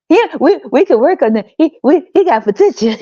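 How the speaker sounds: high, gushing mock voice; mock woman defending her man, high and gushing; mocking hopeful woman. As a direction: pitch up and gush about his potential. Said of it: "grown motherfuckers talking like this".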